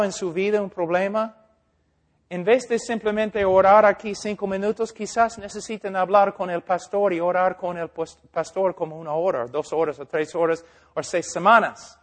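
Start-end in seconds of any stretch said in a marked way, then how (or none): none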